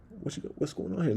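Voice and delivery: high squeaky mouse voice asking around; curious mouse. Retold or imitated. Narrator voicing the mouse peeking at his screen, curious and high. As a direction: playfully voice a nosy mouse.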